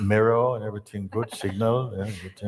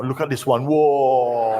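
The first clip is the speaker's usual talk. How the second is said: higher, loud whoa with a laugh; mock alarm; 5 semitones higher, 6 dB louder than usual. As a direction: exclaim as if overwhelmed, laughing. Disciple overwhelmed by thoughts.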